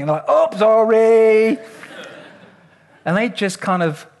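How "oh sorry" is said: loud, drawn-out "oops" style apology; mock careless apology. Imagined crew forgot to clasp the ankles, mock careless apology. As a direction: call it out long and loud, as a joke.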